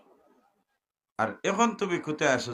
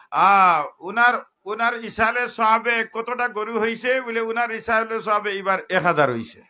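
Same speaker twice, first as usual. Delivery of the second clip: high, loud sarcastic sermon; mock the cow counts to the crowd; loud mocking preaching; 9 semitones higher, 9 dB louder than usual. (ব্যঙ্গ করে চড়া ওয়াজ)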